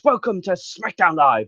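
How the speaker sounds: lightly voiced wrestling commentator's welcome; Mauro Ranallo. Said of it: "Mauro Ranallo's voice".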